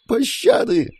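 very high cry for mercy; high-pitched wail of the condemned; desperate plea. A narrator's reading. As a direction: beg for your life in falsetto. Condemned woman begging for mercy.

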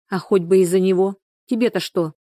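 ordinary delivery

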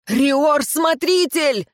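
high, slow drawn-out cry; протяжный отчаянный крик; desperate yell. A narrator's reading. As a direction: call out to stop him, stretching it. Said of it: "Истошный вопль".

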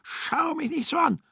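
a widow screaming at her dead husband; yelling. A narrator's reading. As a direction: scream at the ghost behind her, very high. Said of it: "brüllte sie".

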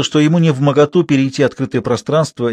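plain reading voice

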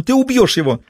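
a high, piercing shriek. A narrator's reading. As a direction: scream at him to stop. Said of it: "завизжала женщина".

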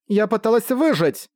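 high-pitched defensive exclamation; exclaiming. A narrator's reading. Accused woman defending her survival, exclaiming. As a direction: cry out in self-defence, pitch up but not shouted. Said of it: "воскликнула она".

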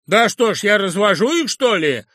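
high, loud aggrieved retort; offended. Acted. Sharikov takes offence at the professor, loud. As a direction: take offence and snap back. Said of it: "обиделся человек".

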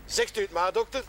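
normal talking voice

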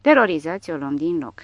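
ordinary delivery

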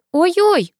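high-pitched alarmed yelp; wailing protest. A narrator's reading. Girl flung over her friend's shoulder, wailing in protest. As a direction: cry out in lamenting dismay. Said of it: "запричитала я".

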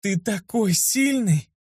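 put-on teen girl praising her rescuer, high slow coo; girl's admiring coo. A narrator's reading. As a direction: gush over the strong knight, slowly. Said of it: "голос Висты".

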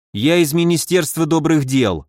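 ordinary delivery, nothing marked

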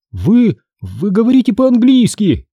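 high-pitched astonished stammer; voiced astonishment; surprised. A narrator's reading. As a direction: blurt it out in high-voiced amazement. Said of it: "удивился я".

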